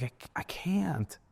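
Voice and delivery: soft whisper, slow and broken; drained whisper, close to tears; whispered despair. Retold or imitated. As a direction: barely breathe the words out, defeated. Breaking down at Christmas dinner.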